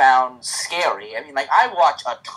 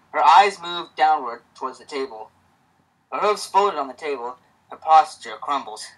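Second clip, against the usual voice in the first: usual speaking voice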